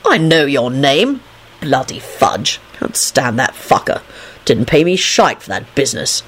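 low, slow grumbling; sullen. A narrator's reading. Concussed Lupin complaining about Dragomir, sullen. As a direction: mumble grievances sulkily. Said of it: "replied sullenly".

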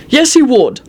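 high, firm contradiction; stern. A narrator's reading. Arthur contradicting Lucretia about helping, stern. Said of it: "Arthur said sternly".

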